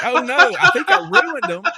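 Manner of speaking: high, loud laughing oh no; mock panic cracking into laughs. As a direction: squeal it out through laughter. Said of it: "and I'm like".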